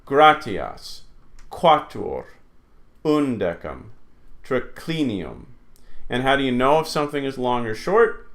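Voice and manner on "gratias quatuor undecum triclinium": "Gratias, quatuor, undecum, triclinium" stressed third from the end; falling word list "Gratias, quatuor, undecum, triclinium"; third-last stress. Shown as a demonstration. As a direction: hit GRA in "Gratias", CLI in "triclinium". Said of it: "goes to the third last syllable".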